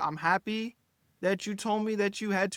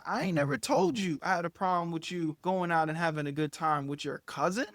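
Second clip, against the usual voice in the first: ordinary delivery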